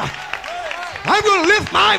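full-force preaching. Real, not acted. Preacher living under the laws of Jesus, jubilant shout. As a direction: build to a roaring, stretched-out declaration.

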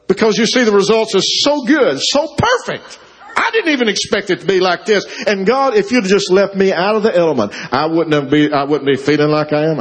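high, worked-up protest; aggrieved complaint. Acted. Jonah complains to God about being dragged in, aggrieved.

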